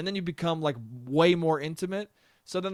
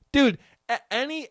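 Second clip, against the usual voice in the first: usual speaking voice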